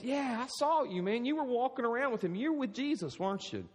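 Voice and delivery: high-pitched, excited finger-pointing accusation; accusing bystander. Acted. Acted accuser recognizing Peter by the fire.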